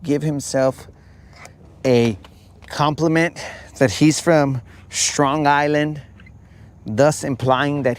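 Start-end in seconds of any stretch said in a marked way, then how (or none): none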